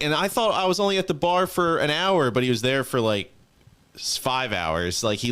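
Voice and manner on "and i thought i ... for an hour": high, loud panicky voice of a hungover buddy; mock-panicked friend squeal; mimicking panicked drunk friend. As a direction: play the friend freaking out, pitched way up. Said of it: "And he's like".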